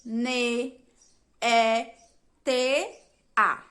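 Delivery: teacher spelling neta out loud for the class; spelling out letters. Shown as a demonstration. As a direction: say each letter of neta apart, with pauses. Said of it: "quais são as letras da palavra neta".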